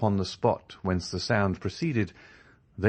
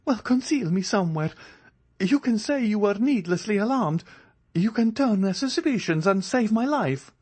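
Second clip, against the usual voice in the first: much higher, pleading and urgent; supplicating voice; 14 semitones higher than usual. A narrator's reading. Andrea begs the ladies to conceal him, pleading. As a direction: coax them into hiding him. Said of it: "this supplicating voice".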